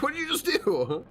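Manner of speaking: laughing, baffled question pitched high. Retold or imitated. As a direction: ask in amused disbelief, voice up high.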